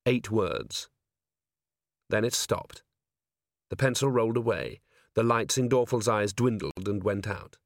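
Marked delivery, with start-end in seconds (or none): none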